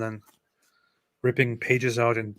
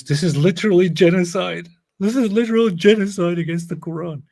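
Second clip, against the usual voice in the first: higher, louder fake indignation; mock outrage; 6 semitones higher, 5 dB louder than usual. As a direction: play it up as outraged. Mock outrage over torn Quran.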